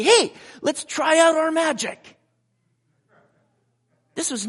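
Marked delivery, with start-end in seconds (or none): 0.0-2.2 loud, high, eager call; hammed-up, over-eager suggestion; mock excited shout. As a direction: call it out like a showman with a trick. Mocks apostles as casual magicians.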